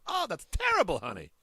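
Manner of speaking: put-on high, wailing old-lady complaint; imitating disgusted woman. Woman after tasting the fake brownie, high disgusted imitation. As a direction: mimic an old lady's disgust, pitch jumping high. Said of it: "she just go".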